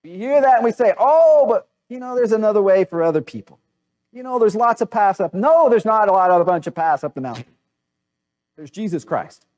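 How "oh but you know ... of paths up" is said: lightly acted, higher-pitched naive protest; mock objection. People answer Jesus' claim with excuses, mock objection. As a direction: voice a well-meaning objector, pitched up.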